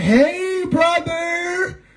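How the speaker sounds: high, sing-song "Hey, brother"; lilting girl's voice drawn out; girly greeting. Retold or imitated. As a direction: mimic a school girl's sweet hello. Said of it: "that girl in school when she walked up like".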